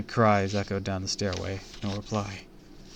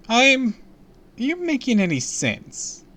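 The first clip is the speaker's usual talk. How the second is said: high, loud disbelief; baffled, almost laughing at him; incredulous; 7 dB louder than usual. Acted. Squinting receptionist, confused.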